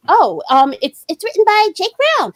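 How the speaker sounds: high, hesitant made-up voice; put-on friend voice. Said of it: "I'm gonna give her this voice".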